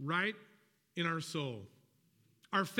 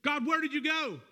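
pleading believer; 5 semitones higher than usual. Retold or imitated. Believer crying out to God during a pause, anxious and pleading. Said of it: "it feels like".

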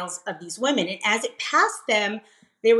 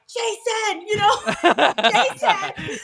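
much higher laughing call of his name; calling Jason; 10 semitones higher, slower than usual. Retold or imitated. Wife calling husband from bed, high laughing call. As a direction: call him from the bedroom, half laughing. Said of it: "I'm like".

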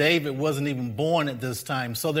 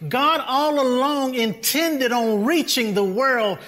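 raised pitch, driving sermon delivery; impassioned preaching; 6 semitones higher, 4 dB louder than usual. Real, not acted. Pastor telling congregation God blessed his people as witness, impassioned preaching. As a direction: preach it with mounting force.

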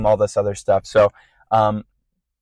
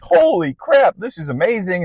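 high, thrilled gasp of wonder; half-performed awe, pitch jumping up; retelling a worship moment with wide-eyed excitement; amazed exclamation; 6 semitones higher than usual. Retold or imitated.

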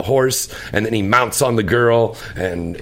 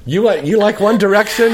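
amused, incredulous and louder; laughing disbelief; 9 semitones higher, 5 dB louder than usual. Real, not acted. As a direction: laugh through the teasing question. Host teasing co-host, laughing disbelief.

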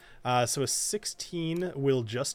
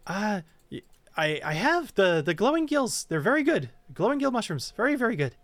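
high, jittery fae voice; squeaky, wound-up pixie; frantic tiny creature; 7 semitones higher than usual. Acted. Quickling voice.

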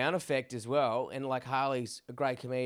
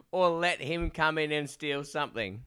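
normal talking voice

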